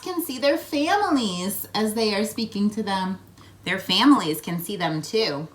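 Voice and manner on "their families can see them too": plain reading voice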